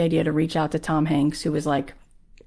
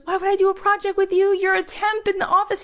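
very high-pitched, exasperated protest; incredulous rebuff; 3 dB louder than usual. Retold or imitated. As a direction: act out dismissing a pushy underling. Director rebuffs the pushy temp.

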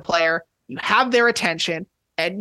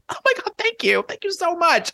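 much higher, breathless delighted thanks; gushing thanks; 10 semitones higher than usual. Retold or imitated. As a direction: gush like you just got a fan.

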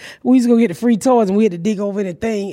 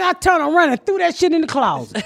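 normal talking voice

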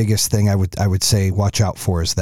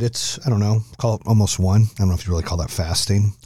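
ordinary delivery

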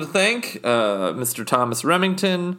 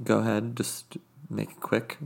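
usual speaking voice

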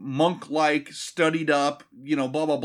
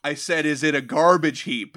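mildly raised, insistent repeat of a quip; repeated louder. Acted. Raiden repeating his joke after no huge laugh, louder. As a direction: push the failed joke a bit louder. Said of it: "he would repeat it louder".

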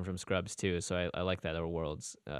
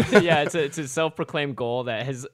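laughing; 5 semitones higher, 7 dB louder than usual. Real, not acted. Laughing about going pro.

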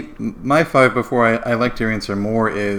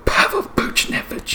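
stage whisper. Pretend. Joins the stage whisper game.

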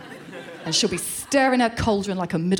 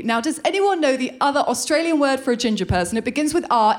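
usual speaking voice

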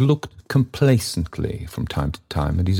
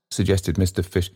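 usual speaking voice

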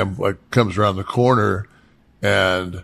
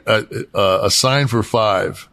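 usual speaking voice

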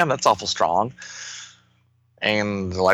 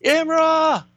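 a high call of "Imra!", only a touch louder; yelling. Acted. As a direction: call her name in alarm. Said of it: "Lightning Lad yells really loudly".

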